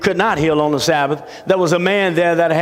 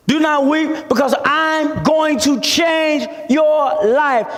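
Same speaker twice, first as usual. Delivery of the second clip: high, declamatory preacher voicing Jesus; ringing pulpit declaration; preached divine promise; 9 semitones higher, slower than usual. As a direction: proclaim it to the congregation, pitch held high. Preacher voices Jesus' promise emphatically.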